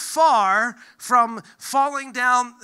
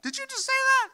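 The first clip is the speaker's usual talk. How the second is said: mock-shocked, much higher squeak; shocked churchgoer; faster than usual. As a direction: gasp it like a scandalised churchgoer. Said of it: "people be like".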